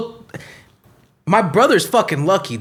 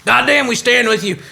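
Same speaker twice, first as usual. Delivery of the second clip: higher, fired-up cheer of support; rallying shout; 6 semitones higher than usual. Acted. Cop to brothers and sisters in blue, rallying shout. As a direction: holler it like a rally cry.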